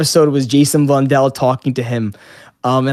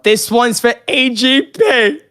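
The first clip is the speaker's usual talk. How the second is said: toast cheer; 9 semitones higher, slower than usual. Retold or imitated. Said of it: "I would always bring up".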